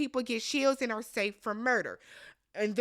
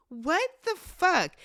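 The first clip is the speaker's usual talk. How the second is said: mock shocked; 6 semitones higher, 3 dB louder, slower than usual. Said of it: "everybody is like".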